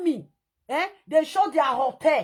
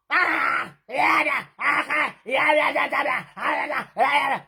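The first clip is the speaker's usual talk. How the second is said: mock shouting; slower than usual. Mocking the man's shouting.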